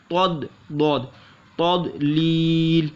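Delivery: drawn-out Quranic syllables. Shown as a demonstration. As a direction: spell out तद and लील slowly. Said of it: "लाम या जिल्ली और लाम दो जिल्लीन है".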